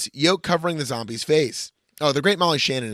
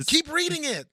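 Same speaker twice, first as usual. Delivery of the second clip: mock pleading; 13 semitones higher than usual. Urging partner in mock exasperation.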